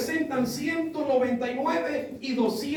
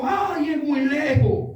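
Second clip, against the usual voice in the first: higher, drawn-out objection; mock complaint; 4 semitones higher, 5 dB louder, much slower than usual. Church brother objects to the pastor's offer, mock complaining. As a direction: imitate a whiny objector, dragging the words out.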